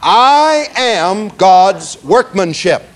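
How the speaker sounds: slow, every word spaced out for the room; slow recitation. Shown as a demonstration. Preacher leading the congregation in a slow spoken recitation. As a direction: lead the room through it word by word. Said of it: "One more time".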